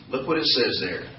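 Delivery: ordinary talk, nothing marked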